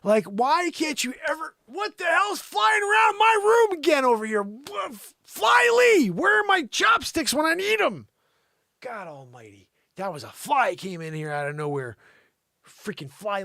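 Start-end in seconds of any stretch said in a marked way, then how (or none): 1.6-8.1 high, loud yelling at a fly; startled shout. Real, not acted. Host shrieks at a fly in his studio. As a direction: shriek at the fly buzzing around.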